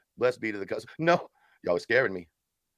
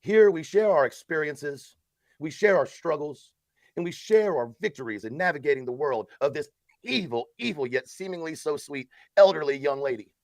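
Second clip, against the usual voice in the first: mock support-group leader; 3 semitones higher than usual. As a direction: lead the meeting like a solemn support circle.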